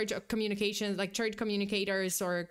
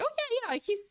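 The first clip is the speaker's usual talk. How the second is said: jumps very high, then bubbles along eagerly; excited at first; 6 semitones higher than usual. Retold or imitated. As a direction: chirp it out like good news. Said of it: "she was at first excited".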